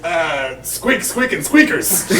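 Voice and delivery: squeaky rat wrestler hype, high and loud; wrestling promo. Acted. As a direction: hype it like a ring announcer rat. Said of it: "That's the best wrestling impression you can do".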